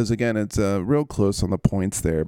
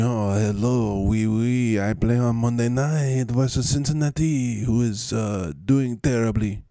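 hammed-up French-Spanish accent; slightly raised, comic French-Spanish accent; Travis Etienne; 3 semitones higher than usual. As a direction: put on a muddled French accent with a Spanish tinge. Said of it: "a Spanish accent and a French accent".

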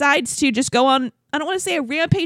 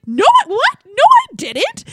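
high, loud mock disbelief; hammed-up shocked backpedalling; stunned denial; 13 semitones higher, 8 dB louder, slower than usual. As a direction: imitate a flustered man protesting. Acts his stunned denial in quarrel.

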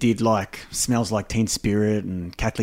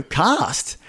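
ordinary delivery

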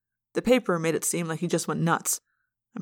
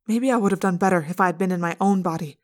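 plain reading voice

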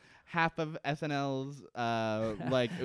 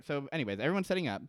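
ordinary talk

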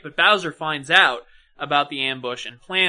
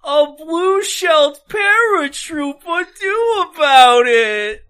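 high, loud, drawn-out cry of outrage; hammed-up despairing howl over the blue shell; mock anguished wail; 10 semitones higher, 6 dB louder, slower than usual. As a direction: wail it like the game personally wronged you.

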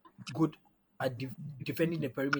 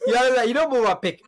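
high, loud wail of protest; 13 semitones higher, 13 dB louder than usual. Real, not acted. Host protests to co-host who guessed his pick, loud high outcry. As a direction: cry out in exaggerated outrage, pitch way up.

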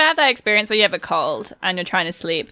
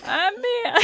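high, softer cartoon squeak; Bugs Bunny; 12 semitones higher, 5 dB quieter than usual. Joining the improvised impressions, small high cartoon voice. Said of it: "do a Bugs Bunny impression".

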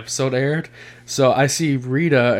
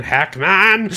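Hackman yell; 7 semitones higher, 4 dB louder than usual. Retold or imitated. Quoting Superman movie line.

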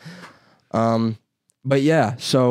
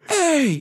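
high, yelping imitation of a dog barking; mock dog bark. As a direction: yip it out like a hunting dog. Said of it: "barking and shit".

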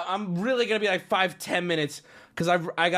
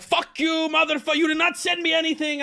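high-pitched mock rage, rapid-fire; livid scammer; 11 semitones higher than usual. Scammer cursing out the streamer over unsent money, mock livid. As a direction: imitate a furious scammer, pitched way up.

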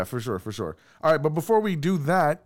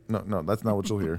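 ordinary delivery

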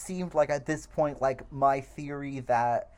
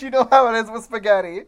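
higher and louder, cracking up at the line; gleeful disbelief; 8 semitones higher, 10 dB louder than usual. Real, not acted. Podcast host repeating a funny movie line, gleeful and loud. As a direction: blurt it out, delighted by the absurdity.

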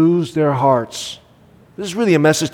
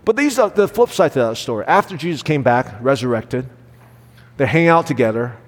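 normal talking voice

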